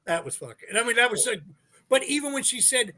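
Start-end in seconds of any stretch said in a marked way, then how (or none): 0.0-0.7 imitating brother's low, soft reaction; understated awe. Repeating what brother Joe said, in understated awe. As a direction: lower and softer, a retold quiet remark. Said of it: "my brother Joe never says anything like that".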